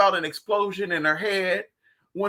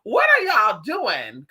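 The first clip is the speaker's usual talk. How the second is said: incredulous; 2 semitones higher, 4 dB louder, much slower than usual. Retold or imitated. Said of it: "I'm just like".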